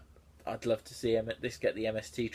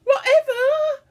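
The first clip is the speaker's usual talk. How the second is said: shrieking, much higher and louder wail; screamed mock yell; 13 dB louder, slower than usual. Shown as a demonstration. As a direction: scream it like an overdramatic goth. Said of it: "I yelled angrily".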